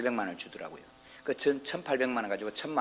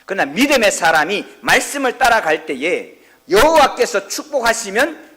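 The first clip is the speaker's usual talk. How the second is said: loud, high, slow sermon shout; preaching at full force; 10 semitones higher, 14 dB louder than usual. Acted. Pastor to congregation, loud high preaching shout. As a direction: proclaim the blessing full out.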